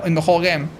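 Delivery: ordinary delivery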